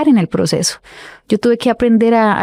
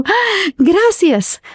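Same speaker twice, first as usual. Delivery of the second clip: retold joyful gasp; 10 semitones higher, much slower than usual. Overjoyed at the vacancy.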